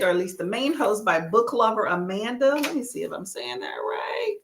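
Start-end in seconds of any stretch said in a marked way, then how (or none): 2.6-4.4 soft, low self-check; hushed aside while checking; quiet aside. Real, not acted. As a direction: say it under your breath to yourself. Checks a name under her breath.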